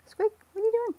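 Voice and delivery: very high, loud cooing at a cat; pet talk voice. Real, not acted. Calling the cat.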